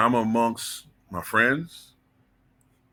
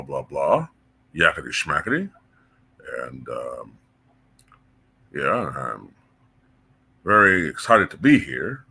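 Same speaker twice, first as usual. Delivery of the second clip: job interview voice; 7 semitones lower than usual. Shown as a demonstration. Speaker demos his job interview voice. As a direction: show how you talk in a job interview. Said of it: "I might talk like this".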